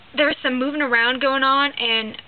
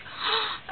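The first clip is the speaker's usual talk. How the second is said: a sharp, high gasp of surprise; shocked gasp; 10 semitones higher, 7 dB quieter than usual. Retold or imitated. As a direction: gasp like the lady just saw her dog return. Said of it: "that's my impersonation".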